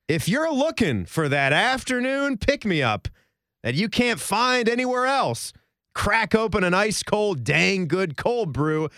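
high, upbeat commercial pitch; mock ad read. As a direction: sell it like a radio ad. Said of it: "this could be sort of like a mock if we're gonna get some advertisements".

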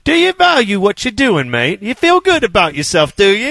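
high mock British needling; British trash talk. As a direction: needle them like a British heckler. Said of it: "British Isles trash talk".

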